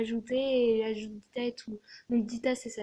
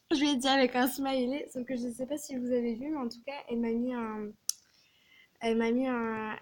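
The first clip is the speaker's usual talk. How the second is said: ordinary talk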